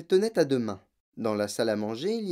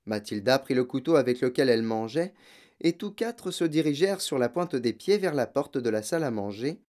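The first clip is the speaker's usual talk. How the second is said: usual speaking voice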